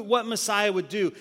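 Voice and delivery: usual speaking voice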